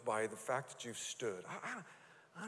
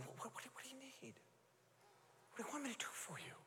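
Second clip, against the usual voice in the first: hushed tender asking; 10 semitones higher, 14 dB quieter, faster than usual. Acted. Jesus voiced asking, hushed.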